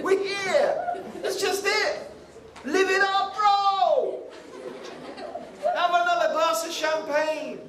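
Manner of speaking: high, whooping party cheer; carefree passenger. Acted. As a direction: cheer them on in a hammed-up high voice. Acting carefree passenger urging party.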